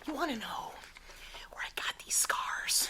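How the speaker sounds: imitating Heath Ledger's Joker. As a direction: hush the line like a creepy villain.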